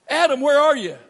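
high, loud call to Adam; God calling out. Acted. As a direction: call out like God searching the garden. Preacher voices God calling Adam.

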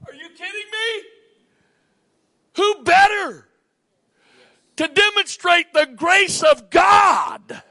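high, loud, slow shout of conviction; preacher rising to full force; preaching shout. Real, not acted. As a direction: shout it to the back pews. Preacher shouts rhetorical question.

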